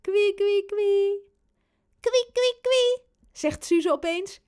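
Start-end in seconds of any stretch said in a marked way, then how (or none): 0.0-1.2 high, louder teasing squeal; playful tickle squeal. Acted. Robin about to tickle baby Suze, high playful squeal. 2.0-3.0 very high, loud little squeak; baby squeal. Acted. Baby Suze suddenly copies her brother, very high squeal.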